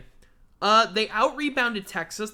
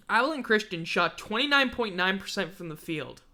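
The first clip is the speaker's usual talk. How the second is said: ordinary delivery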